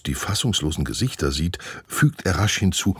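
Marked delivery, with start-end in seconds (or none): none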